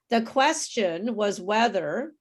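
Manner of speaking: slow, measured QUESTION WAS WHETHER for repeating; broken-up model phrase. Shown as a demonstration. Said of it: "I'll break it up".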